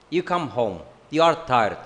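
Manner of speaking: usual speaking voice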